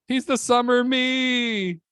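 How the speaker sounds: high, slow squealing line; high squealy delivery. Retold or imitated. On stage after the host's intro, a slow high squeal. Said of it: "This guy talks like this".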